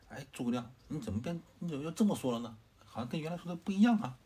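rising pitch and volume, as if he can't believe what he's hearing; bewildered protest. Acted. Lu Su confused by Zhuge Liang.

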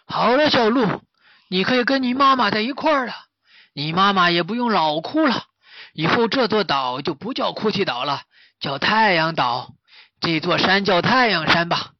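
high, loud character voice at first, easing slightly; cheerful master. Acted. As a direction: announce good news to the fawn. (大师高声欢快宣布)

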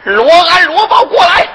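loud, high summons of 罗安 and 罗豹; calling out. Acted. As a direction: bellow for the servants across the hall. Old lady summons two servants. (高声喊人)

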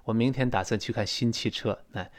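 ordinary talk

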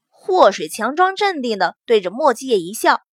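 ordinary delivery, nothing marked

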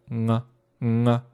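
nasal ng snapping open into a; ng-ah, tongue root pulling off the soft palate; ugly throat release. Shown as a demonstration. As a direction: gross ng-ah noise. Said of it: "这个恶心的声音".

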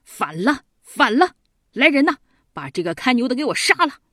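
outraged ruler crying out an order; furious storyteller's yell; angry shouting. A narrator's reading. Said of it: "嚷着说".

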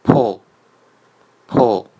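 loud 破 with a strong puff; aspirated demo. Shown as a demonstration. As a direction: blow out 破 hard. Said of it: "第三呢是p元音o".

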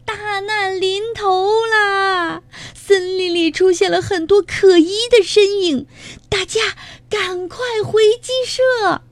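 very high, wailing alarm; frantic hen crying disaster; panicked shouting. A narrator's reading. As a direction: warn everyone in high-pitched panic. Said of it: "卡里姨妈惊慌地大喊".

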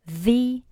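"the" said as thee before a vowel. Shown as a demonstration. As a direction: say "the" with a long ee sound. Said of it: "它读作the".

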